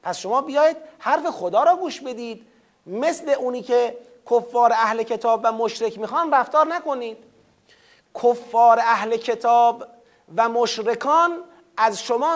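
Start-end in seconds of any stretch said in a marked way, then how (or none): none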